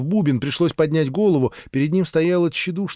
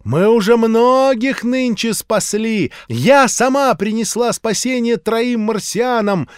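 high, loud, slow preaching voice; fervent street-preacher sermon; zealous old preacher; 9 semitones higher, 6 dB louder, slower than usual. A narrator's reading. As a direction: warn him of doom like a revival preacher.

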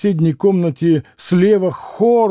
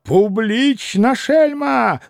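high-pitched insult; furious cry; 6 semitones higher, much slower than usual. Acted. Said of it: "закричал".

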